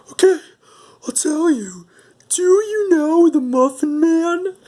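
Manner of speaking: tiny, very high falsetto voice; gingerbread boy. Retold or imitated. Tortured cookie gives in and confesses, high squeaky falsetto. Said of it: "gingerbread boy goes".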